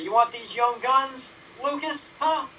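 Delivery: put-on taunting young gun. Young publicists taunting older ones.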